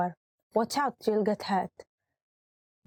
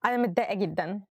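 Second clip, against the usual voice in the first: upset; much faster than usual. Shown as a demonstration. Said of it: "I cannot say it with a smiling face".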